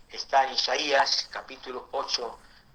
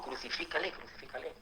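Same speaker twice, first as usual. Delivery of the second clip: a hostile cry whispered, much softer; whispered crowd cry; 13 dB quieter, faster than usual. Retold or imitated. Preacher voices the crowd turning on Jesus, whispered. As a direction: breathe the demand almost silently. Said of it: "después dijo".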